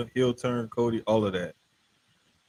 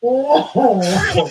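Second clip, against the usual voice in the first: laughing; 13 semitones higher, 8 dB louder than usual. Real, not acted. Laughing at the promo mix-up.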